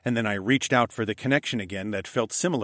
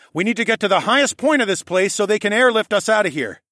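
loud, raised urgent instruction; shouting ahead; 10 semitones higher, 7 dB louder than usual. A narrator's reading. Alex telling Horace the escape plan, shouted. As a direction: call the plan out to someone ahead. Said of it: "I shouted ahead at Horace".

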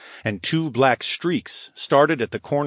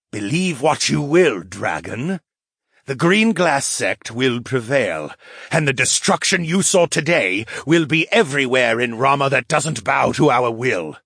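menacing threat; 4 semitones higher, 4 dB louder than usual. Acted. Tiger man's threat.

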